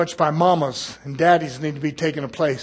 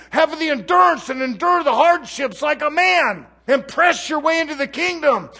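loud, driving preacher's cry; full-force pulpit preaching; fiery preaching; 7 semitones higher, 7 dB louder than usual. Acted. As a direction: preach it hard to the congregation.

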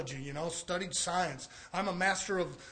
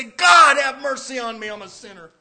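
crying out to God, then fading; 4 semitones higher, 14 dB louder than usual. Retold or imitated. Sinner breaking before God.

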